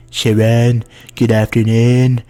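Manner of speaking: slow, loud, mock-posh greeting; posh British voice. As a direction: play it hammy and proper, slowly. Voicing Hanako character.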